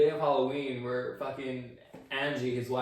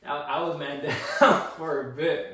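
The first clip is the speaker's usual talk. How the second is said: loud laugh breaking into the words; 5 dB louder than usual. Real, not acted. Speaker admits being laid up after the fall, laughing. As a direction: crack up midway, slowing down.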